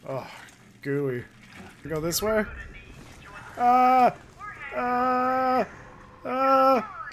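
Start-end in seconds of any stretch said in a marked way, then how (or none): 3.6-7.1 long high held cries; sustained alarmed "ah"; distressed wails. Real, not acted. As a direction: wail on one high note. Trapped by game monster.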